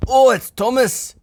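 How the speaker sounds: very high, slow discovery; surprised cry. Acted. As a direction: realise who's trapped. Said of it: "cried Douglas".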